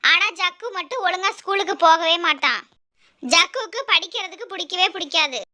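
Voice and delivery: plain reading voice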